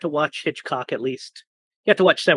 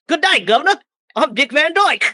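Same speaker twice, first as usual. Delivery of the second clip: mock Cockney accent; 11 semitones higher, 7 dB louder than usual. Joking with friends about doing a podcast in British accents, hammed-up Cockney.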